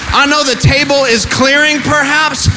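rally the church, voice lifted high; preaching at full force. Acted. Preacher firing up the congregation, full-voiced sermon climax.

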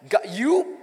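ordinary talk, nothing marked

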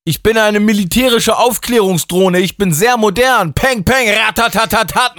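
very high, loud robotic drone voice; military drone. A narrator's reading. Mike pretends to be a military drone, very high and robotic. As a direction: play a machine gunning. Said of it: "machte er".

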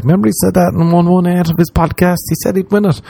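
high-pitched mock reminiscing; imitated listeners. Listeners recalling his podcast prediction, high imitated chatter. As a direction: put on a high voice for amazed fans.

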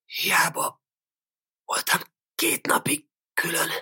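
husky, half-whispered croak from the grave; hoarse, haunted whisper with heavy pauses; sepulchral hoarse voice. A narrator's reading. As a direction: rasp it out hollowly, barely voiced. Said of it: "Egy síri, rekettes hang".

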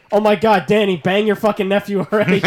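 loud, high, hammed-up exasperation; mock impatient yell. Meme viewer egging Dany on, mock impatient yell. Acted meme reaction, season seven.

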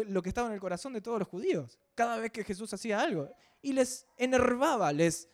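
normal talking voice

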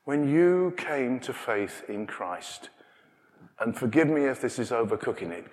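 usual speaking voice

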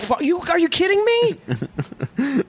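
high-pitched, drawn-out disbelief; incredulous. Real, not acted. Answering a friend who wouldn't want that, high incredulous protest. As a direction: balk in high-pitched amazement.

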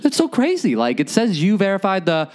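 very high, mock-puzzled taunting; string him along in fake confusion; mocking bank rep. Bank rep pretends to be baffled, very high and teasing. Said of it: "And he's like".

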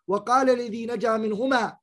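fast, chanted verse recital; Quran recitation. Acted.

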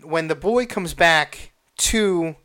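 usual speaking voice

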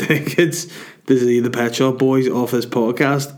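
normal talking voice

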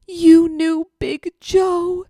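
high, slow, wondering disbelief; teary astonished owner. Retold or imitated. Grieving owner asking the vet tech, high and slow. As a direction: ask it slowly as if tears are coming. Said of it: "And she was like".

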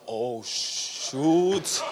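hushed dread. Retold or imitated. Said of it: "I was like".

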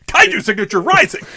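loud, high toy-commercial shout; gruff tinny action figure. Retold or imitated. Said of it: "toy commercial kind of line".

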